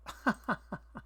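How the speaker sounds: short breathy chuckle in bursts; amused laugh. Real, not acted. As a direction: laugh to yourself at what you read. Laughs at what he is reading.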